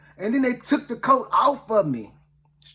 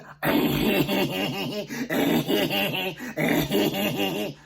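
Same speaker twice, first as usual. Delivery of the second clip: long crazy giggle in bursts; mock a brother's silly giggle; rapid, stuttering cackle put on; imitated crazy giggle. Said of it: "a giggle".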